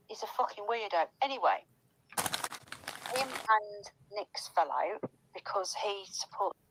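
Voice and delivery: ordinary delivery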